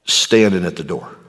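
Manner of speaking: slow, low and soft preaching; hushed solemn testimony. Real, not acted. Preacher recalls being comforted in a dark time, hushed and solemn. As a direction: let it sink low and quiet, with weighty pauses.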